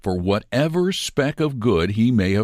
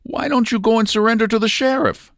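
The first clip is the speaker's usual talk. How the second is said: sidekick urging; 13 semitones higher, 3 dB louder, faster than usual. A narrator's reading. Sidekick advises surrender.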